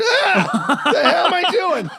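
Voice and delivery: high, loud panicked yelp; hammed-up horror, pitch shooting up; horrified scream. Soldier discovering he shot children, mock-horrified scream.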